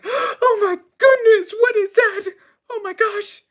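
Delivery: very high, loud squeal of alarm; squeaky, shrieking waitress voice; panicked waitress. Acted. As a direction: shriek it as a terrified waitress in falsetto. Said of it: "the waitress sees it, and she's like".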